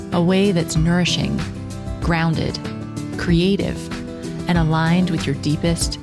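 measured, low-key intro narration; podcast intro read. Acted. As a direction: read it like a show opener. Scripted podcast intro over music.

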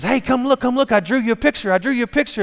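put-on high, eager child's voice; excited kid. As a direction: play an excited little kid showing off a drawing. Pastor voices excited child showing drawing.

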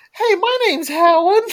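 put-on high, slow chirpy girl voice; mock young girl. Listener imagined greeting hosts, high mock girl voice. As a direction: voice a cheerful teen introducing herself.

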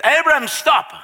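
high, loud sudden command; urgent heavenly shout to stop; commanding call. Acted. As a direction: call out to halt him. Voice from heaven calls.

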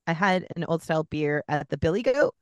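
ordinary talk

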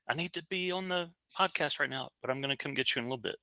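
quiet and quick, turned away from the mic; hushed aside. Real, not acted. Hushed aside to kid during podcast.

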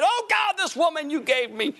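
high, wailing mock lament; mock crying prayer. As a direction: ham up a husband crying to God. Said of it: "on the floor crying and saying".

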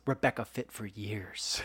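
low, very soft deadpan aside; Jesse Eisenberg. Retold or imitated. Podcaster retelling the movie put-down, muttered low. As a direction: imitate a muttered put-down, dropping right down. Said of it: "The way he delivers that line".

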